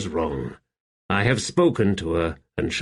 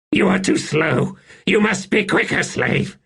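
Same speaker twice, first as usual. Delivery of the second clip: high, agitated reprimand; high-pitched imperious impatience; scolding emperor; 11 semitones higher than usual. Acted. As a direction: berate your slave for dawdling. Emperor scolds his slave.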